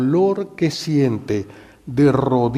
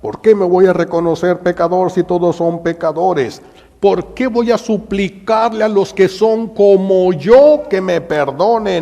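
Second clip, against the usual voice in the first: louder, higher, bitter refusal; Gestas defiant; 5 semitones higher, 6 dB louder than usual. Acted. Gestas refusing to repent on the cross, angry. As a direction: spit it out like a proud thief. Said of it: "Gestas no acepta".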